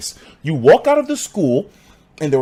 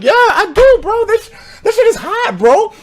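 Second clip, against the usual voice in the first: much higher, cocky answer; proud high brag; 5 dB louder than usual. Retold or imitated. Me answering the classmates, high cocky brag. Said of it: "I was straight up like".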